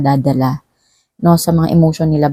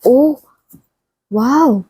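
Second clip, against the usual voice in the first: surprised wow; 7 semitones higher than usual. Real, not acted. Tarot reader surprised by Leo's cards, exclaiming. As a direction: react to a lucky card.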